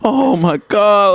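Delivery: high, drawn-out swoon; mock ecstasy. Imitating a fan losing it in the corner, ecstatic. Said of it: "having an orgasm in the corner".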